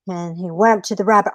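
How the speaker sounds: usual speaking voice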